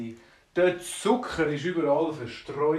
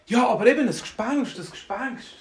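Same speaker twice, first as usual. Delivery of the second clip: higher-pitched worried baker; alarmed insistence; 8 semitones higher, 4 dB louder than usual. Acted. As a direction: insist on it with a touch of acted fright. Frightened baker insists on a ghost.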